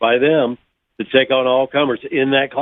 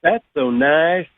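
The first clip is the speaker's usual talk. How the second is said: slow, sugary Southern drawl, pitched up, a touch louder; slow, higher Southern drawl with a fake-sweet edge; Southern girl sweetness; 4 semitones higher, 4 dB louder, much slower than usual. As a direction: drawl it like a Southern belle with a hidden sting. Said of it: "Southern girls have to say to other Southern girls".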